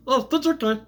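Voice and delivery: high, squeaky alien voice; Stitch voice. Retold or imitated. Reviewer voicing Stitch comforting Lilo, high squeaky. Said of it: "he's like".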